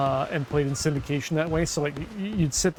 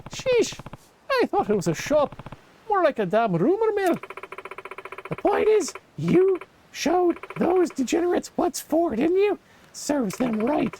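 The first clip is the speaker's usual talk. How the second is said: higher, lively voicing of a shopkeeper; game character voice; 11 semitones higher than usual. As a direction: read the NPC's lines up and chipper. Voices NPC in game dialogue.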